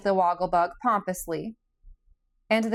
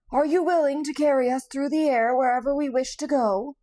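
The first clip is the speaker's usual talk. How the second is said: higher, kindly straw-man voice; kindly Scarecrow; 7 semitones higher than usual. A narrator's reading. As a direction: coax him gently as the kind Scarecrow. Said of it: "said the Scarecrow kindly".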